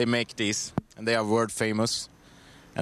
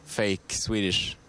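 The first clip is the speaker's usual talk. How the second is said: ordinary talk, nothing marked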